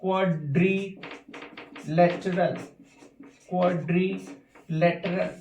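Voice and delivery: Quadrilateral stretched very slowly, each syllable apart; drawn out slowly. Shown as a demonstration. Teacher slowly splitting quadrilateral into syllables. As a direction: spell the word out in sound, slowly. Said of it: "जैसे मैंने कहा".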